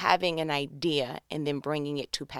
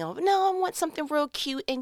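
mock bride; 8 semitones higher than usual. Voicing a bride's wish.

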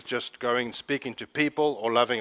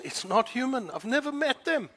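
much higher with passion; rising wonder; 10 semitones higher, 4 dB quieter than usual. Real, not acted. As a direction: let the wonder lift your voice.

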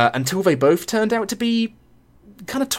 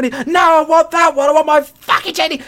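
high, loud imitated demand; mock angry yell; 12 semitones higher, 4 dB louder than usual. Girlfriend yelling at boyfriend for her bottle. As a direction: yell it like a drunk spoiled demand.

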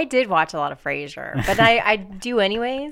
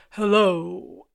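imitating Cam Winston. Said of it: "tries to do Cam's voice".

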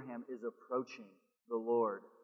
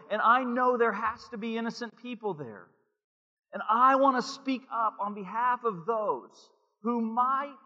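high, loud earnest plea; preacher voicing Abraham resolving to intercede; Abraham's resolve; 10 semitones higher, 8 dB louder, slower than usual. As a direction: speak up for the innocent. Voices Abraham's inner resolve.

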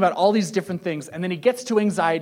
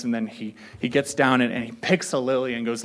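usual speaking voice

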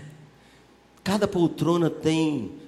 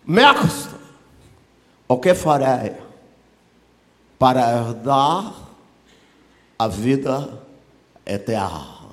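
drawn-out learned doctor, tapering down; hammed-up scholar's question; pompous doctor; 5 dB louder, slower than usual. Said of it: "Já viu um doutor assim?".

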